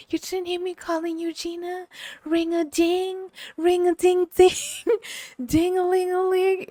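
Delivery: put-on high singsong old man's voice, ringing out; mock old man singsong. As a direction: sing the bell at her like a teasing old man. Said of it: "he was like".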